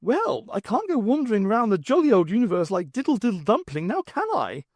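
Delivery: high, fussy upper-class twit; a silly toff pleading his case. Acted. Jeremy justifying himself to the Brigadier, high and fussy. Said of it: "Jeremy explains the shoes".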